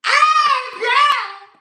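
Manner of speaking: very high, loud cry of laughter; a wild laughing squeal; laughing shriek. Real, not acted. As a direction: let out a shrill howl of laughter. Bursting out laughing.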